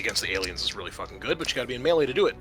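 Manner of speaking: ordinary talk, nothing marked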